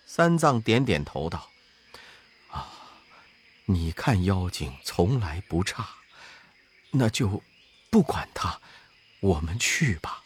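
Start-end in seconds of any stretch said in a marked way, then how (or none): 2.5-10.2 put-on low, soft voice of an old monk; gentle monk. A narrator's reading. Master asks his disciple for food, low and soft. Tang Seng voiced in story.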